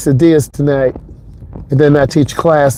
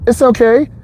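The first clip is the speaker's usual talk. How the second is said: raised voice; 7 semitones higher than usual. Retold or imitated. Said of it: "I'm like".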